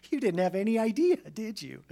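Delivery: high, fast, loud taunt; mock teasing. Listener ribbing the preacher before God, mock teasing. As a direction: rib him with a jabbing tag question.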